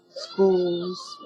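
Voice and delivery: normal talking voice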